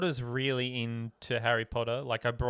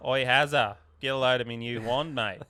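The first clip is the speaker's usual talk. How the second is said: louder broad Aussie bloke voice; broad Australian Harry Potter; 6 dB louder than usual. Pretend. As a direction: greet Hazza like an Aussie mate. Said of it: "The Australian has a Potter".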